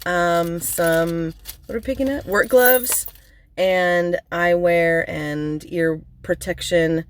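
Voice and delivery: usual speaking voice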